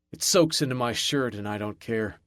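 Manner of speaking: plain reading voice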